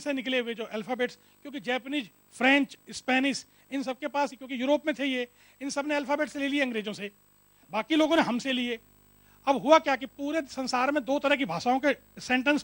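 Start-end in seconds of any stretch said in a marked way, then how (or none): none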